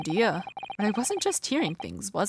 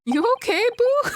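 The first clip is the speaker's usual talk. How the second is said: teasing; 6 dB louder than usual. Acted. Teasing the odd prisoner.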